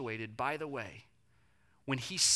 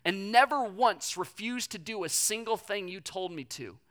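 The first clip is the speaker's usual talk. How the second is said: raised, aggrieved complaint; older son yelling at his dad, bitter; resentful son; 6 semitones higher, 6 dB louder than usual. Retold or imitated. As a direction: list your grievances at your father. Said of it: "yelling at his dad".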